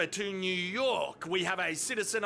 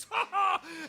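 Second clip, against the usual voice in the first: high delighted laugh; short loud laugh; burst of laughter; 9 semitones higher, 3 dB louder than usual. Real, not acted. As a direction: crack up at your own joke. Laughs at own joke.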